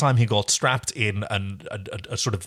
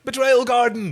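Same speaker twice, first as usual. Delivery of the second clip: gleeful announcer-style call; excited exclamation; 11 semitones higher, 3 dB louder than usual. Real, not acted. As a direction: announce it higher, with gusto. Repeats location name with gusto.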